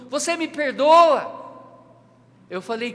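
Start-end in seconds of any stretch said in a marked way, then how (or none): 0.1-1.4 high, loud drawn-out call; raised-voice retold yell, stretched out; shouting from afar. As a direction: shout it out slowly as if across a distance. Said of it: "gritou lá do fundo".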